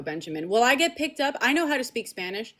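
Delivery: usual speaking voice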